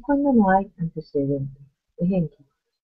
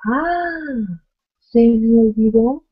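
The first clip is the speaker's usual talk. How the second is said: high ah, then a louder regretful line; a teacher hamming up a forgotten call; sudden dismay, pitched up and louder; dismayed exclamation; 4 semitones higher, 5 dB louder, much slower than usual. Shown as a demonstration. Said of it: "Interjección exclamativa".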